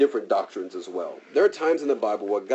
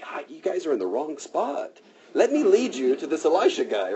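higher, breezy, mock helpful guide voice; sly Elisha; 6 semitones higher than usual. Elisha to blind Syrian soldiers, lightly voiced mock helpful. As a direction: play innocent while leading them astray.

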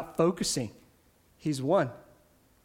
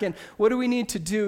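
ordinary delivery

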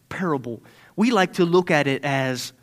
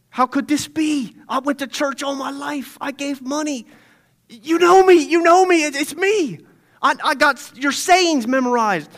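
higher, rising to loud cry; desperate protest; 9 semitones higher, 5 dB louder than usual. Acted. Rejected churchgoer protesting to Jesus, high and frantic. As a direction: plead your church record in panic.

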